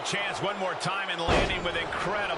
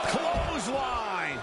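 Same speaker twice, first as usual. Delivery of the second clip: excited call; 6 semitones higher, much slower than usual. Acted. Commentator calls a big move.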